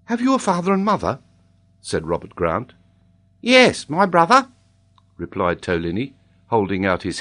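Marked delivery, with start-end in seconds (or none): 0.0-1.2 put-on high, quick boy's question; curious boy. A narrator's reading. Robert questions the child. 3.4-4.5 put-on high, loud child with accent; Tolini. A narrator's reading. Child greets Robert as brother.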